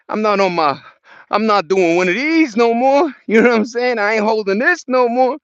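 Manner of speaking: loud, high, fed-up protest; loud exasperation. Real, not acted. Complaining about holding camera.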